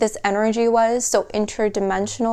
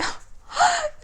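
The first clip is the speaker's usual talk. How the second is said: a sudden high squeal of a laugh; 4 dB quieter than usual. Real, not acted. Reader breaking into a high laugh mid-reading. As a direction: laugh up high, caught by surprise.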